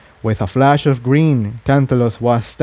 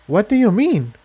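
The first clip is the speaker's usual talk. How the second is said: high gentle pony voice; a high worried young mare; concerned filly; 10 semitones higher than usual. Acted. Tiptoe questions Jigsaw.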